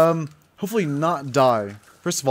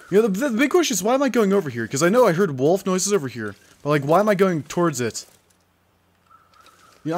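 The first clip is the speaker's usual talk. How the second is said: normal talking voice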